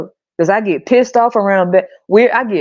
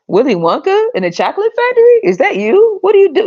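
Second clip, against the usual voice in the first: high incredulous teasing; 10 semitones higher than usual. Retold or imitated. Mock addressing Willy Wonka.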